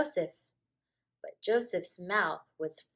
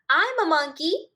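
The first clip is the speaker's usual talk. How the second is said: put-on high, loud silly kid; excited child; 9 semitones higher, 10 dB louder than usual. A narrator's reading. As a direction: announce it proudly. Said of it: "he said".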